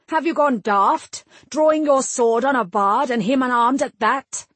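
high, loud angry rebuke; angry lord. A narrator's reading. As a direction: scold him furiously.

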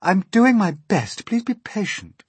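high, harried little glowworm voice; high, loud protest easing into a plea; poor glowworm. A narrator's reading. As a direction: plead as a put-upon small creature. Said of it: "answered the poor glowworm".

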